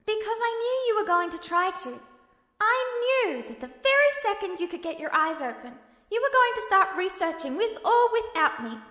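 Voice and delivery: holding back emotion as he explains; Al, a touch emotional. Acted. His voice wavered a little bit.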